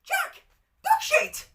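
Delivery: put-on very high, loud, slow gleeful little girl; a toddler delighted with herself. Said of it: "this time with glee".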